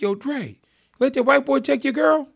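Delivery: lightly voiced cousin egging him on; teasing cousin. Cousin teasing about girlfriend.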